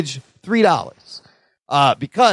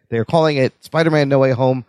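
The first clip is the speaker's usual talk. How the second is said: usual speaking voice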